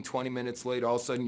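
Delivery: ordinary delivery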